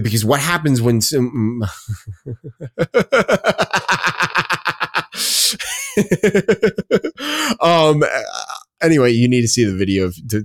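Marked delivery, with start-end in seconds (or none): 1.7-7.5 long, high-pitched wheezing giggle; helpless laughing fit. Real, not acted. Podcast host losing it mid-rant, helpless high laughter. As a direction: crack up and keep laughing breathlessly. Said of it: "why I'm laughing at that".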